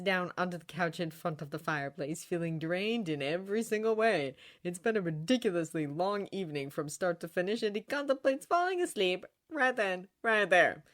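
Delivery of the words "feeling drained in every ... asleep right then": plain reading voice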